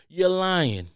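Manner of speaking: ordinary talk, nothing marked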